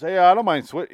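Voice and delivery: mock-polite passenger piping up; polite stuffy man. Stuffy passenger offering to switch seats, politely. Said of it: "he's polite as fuck".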